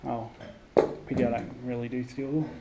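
ordinary talk

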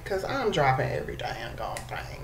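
sheepish whisper; whispered aside. Real, not acted. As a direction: whisper it like a confession. Aside about dropping things.

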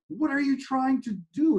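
high, alarmed shout down below. Acted. Man on the bridge shouting down to the stranger, high alarmed shout. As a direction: call down over the bridge in alarm. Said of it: "he shouted to the stranger below".

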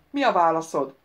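loud demand for an answer; thundering demand. A narrator's reading. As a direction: boom the question at him. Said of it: "dörrent rá az említett". (dörgő hangú követelés)

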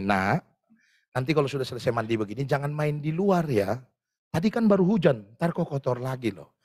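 put-on low, gentle warning to a child; mother cautioning her kid after bath.

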